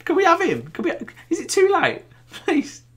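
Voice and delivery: very high, laughing plea; mock begging. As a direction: plead in a squeaky little voice, half laughing. Fan begs for a similar player.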